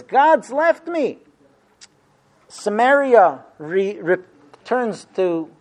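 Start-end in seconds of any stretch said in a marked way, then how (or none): 0.0-1.2 lamenting Zion. Retold or imitated. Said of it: "Zion says".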